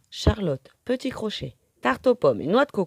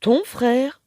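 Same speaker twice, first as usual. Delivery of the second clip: high rising amazed question; astonished echo; 7 semitones higher, 5 dB louder than usual. A narrator's reading. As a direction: echo it in disbelief. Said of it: "répète Plume très étonnée".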